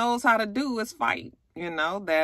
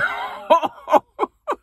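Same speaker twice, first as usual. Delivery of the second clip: high laugh; 11 semitones higher, 4 dB louder than usual. Real, not acted. Laughs at Bambi crying.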